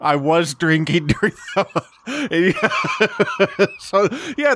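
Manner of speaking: laughing through the admission. Real, not acted. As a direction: break up laughing as you own up, pitch climbing. Laughs while admitting drinking during review.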